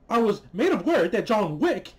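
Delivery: mock her phony, overacted way of talking; Star Trek villain impression. Mocking the adjudicator's phony speech as a Star Trek villain. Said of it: "She talks like a fucking Star Trek villain".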